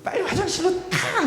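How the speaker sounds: high, loud angry command; shouted order. Acted. Mother drives daughter to the bathroom, shouting. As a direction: yell it to hurry someone along.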